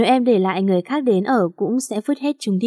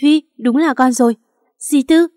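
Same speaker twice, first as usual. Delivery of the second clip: louder, higher glad exclamation; joyful recognition; 5 semitones higher, 5 dB louder than usual. A narrator's reading. Aunt and girl reunited after years, joyful recognition. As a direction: cry out in happy amazement. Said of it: "đã không khỏi vui mừng".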